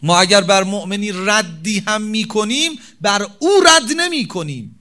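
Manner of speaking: preacher proclaiming to the congregation; preaching at full force. Real, not acted. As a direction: drive the point home hard. Preaching emphatic point.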